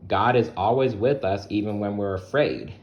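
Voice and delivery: ordinary delivery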